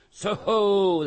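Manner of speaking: loud high shout into the mist; guard roars a challenge at the rider; roaring hail. A narrator's reading. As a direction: roar it out as loud as you can. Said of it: "sang out as loud as he could roar".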